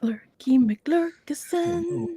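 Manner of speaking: slow playful whisper. Pretend. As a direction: breathe the silly name out slowly. Playful nickname for a lurker.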